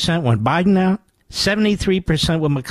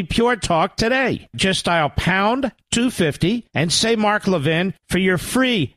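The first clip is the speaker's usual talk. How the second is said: upbeat, slightly raised pitch sponsor read; 4 semitones higher than usual. Acted. Host pitching his sponsor to listeners, upbeat ad read. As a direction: sell the phone deal with a brisk pitch.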